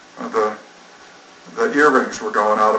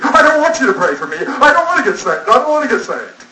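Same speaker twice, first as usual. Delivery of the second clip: put-on much higher, insistent teen girl voice pushing back; defiant teen refusal; 12 semitones higher, 3 dB louder than usual. Erica refusing the preacher's prayer, high and defiant. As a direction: refuse flatly, arms folded.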